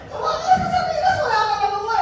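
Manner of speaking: amused giggling delivery; laughing. Real, not acted. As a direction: laugh out the sardine line. Laughs at his own sardine joke.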